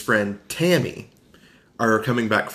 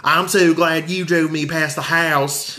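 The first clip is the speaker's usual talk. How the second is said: high, slow mock Southern drawl; exaggerated Tammy accent; 6 semitones higher, 3 dB louder, slower than usual. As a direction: mimic her odd Southern accent, louder and drawn out. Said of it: "What was her accent?".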